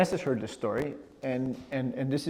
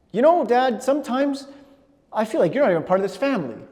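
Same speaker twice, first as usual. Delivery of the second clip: much higher and louder, accusing; aggressive child; 10 semitones higher, 9 dB louder than usual. Retold or imitated. Son tells dad he is not family, loud and high. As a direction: cry it out as a hurt kid. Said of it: "really aggressive".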